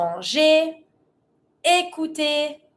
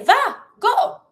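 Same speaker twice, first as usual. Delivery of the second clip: very high, punchy order; super energetic command; 11 semitones higher, 4 dB louder than usual. Shown as a demonstration. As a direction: send the animal off eagerly. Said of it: "We always try to be super energetic".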